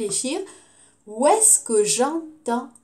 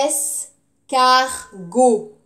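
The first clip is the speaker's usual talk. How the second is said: "Escargot" loud, slow es - car - go; syllable by syllable; 7 dB louder, much slower than usual. Shown as a demonstration. Teacher sounds out a word for pupils, loudly syllabified. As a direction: punch each syllable of "Escargot" separately.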